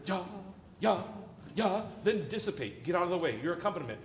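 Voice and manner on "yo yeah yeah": short punched "Yo" and "yeah" on the beat; single accented syllables, spaced out; little accent. Shown as a demonstration. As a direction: hit each "yeah" and let it die away. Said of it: "So a little accent like".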